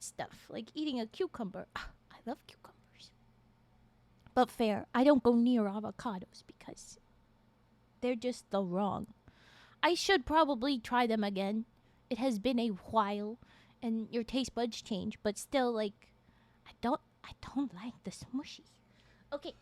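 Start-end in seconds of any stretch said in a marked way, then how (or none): 1.8-3.1 whispering. Real, not acted. Whispered aside. 16.7-18.6 whispering. Real, not acted. Whispered aside.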